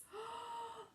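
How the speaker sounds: high sharp intake of breath; shocked gasp. Acted. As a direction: gasp in horror. Shocked gasp before Billy shouts.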